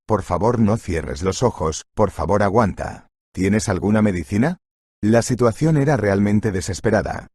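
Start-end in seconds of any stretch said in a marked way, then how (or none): none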